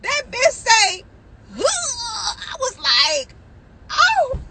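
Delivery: high, squealing retelling with drawn-out words; shocked outburst. Retold or imitated. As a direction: shriek it in mock horror. Acting shock at opening the phone.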